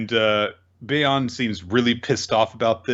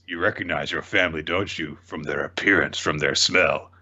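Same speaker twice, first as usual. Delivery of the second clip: low, menacing villain purr; sinister taunt; 6 semitones lower than usual. Retold or imitated. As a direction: savor the cruel reveal.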